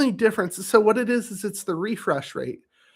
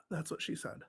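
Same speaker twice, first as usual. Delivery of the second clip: breathy whisper, much softer; whispered joke; 18 dB quieter than usual. Real, not acted. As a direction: slip the joke out under breath. Muttered joke aside.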